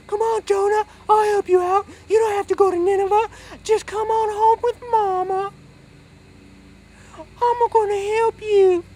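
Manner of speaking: high, loud falsetto mama; Mama paddles up to rescue Jonah, high falsetto; doting mama voice. Acted. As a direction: coo and call like an overbearing mother.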